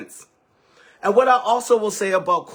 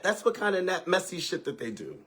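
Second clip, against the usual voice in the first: ordinary talk, nothing marked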